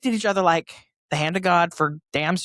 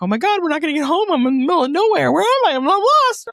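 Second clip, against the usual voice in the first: high, loud, fast panicky wail, half reliving it; retold panicked crying; 9 semitones higher, 4 dB louder than usual. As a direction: blurt it out like a lost teenager about to cry. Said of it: "I started to cry 'cause I was like".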